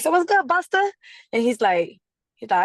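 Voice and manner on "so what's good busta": high, loud, buzzed hello; hyped greeting. Retold or imitated. Tipsy rapper greeting [name], high loud hype. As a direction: bounce it at him, a couple shots in.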